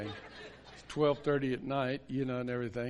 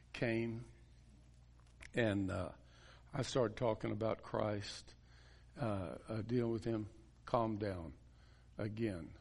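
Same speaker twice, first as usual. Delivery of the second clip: normal talking voice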